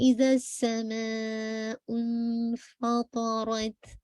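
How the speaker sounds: slow recited इज़ा समाउ इनफ़तरत with the hamza dropped; slow, careful Quran recitation of इज़ा समाउ इनफ़तरत; correct Quranic reading. Shown as a demonstration. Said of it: "बल्कि हम पढ़ रहे हैं".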